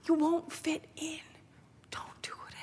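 whispered, like a secret warning; whispered warning against moving; hushed warning. Retold or imitated. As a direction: breathe it as a grave warning. Branch president's warning, hushed.

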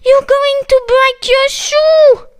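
high, loud, alarmed cry; distressed shout. Acted. Kakamustik yelling at Suki, high and loud. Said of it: "shouted Kakamustik, distressed".

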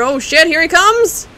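loud, high-pitched real alarm, building; alarmed exclaiming. Real, not acted. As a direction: blurt it out as the huge foe charges. Huge boss charges at player.

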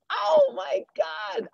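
high-pitched, drawn-out "oh my God" of surprise; astonished exclamation. Real, not acted. Teacher reacting to a student's tricky pick, astonished. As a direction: cry out slowly, high with disbelief.

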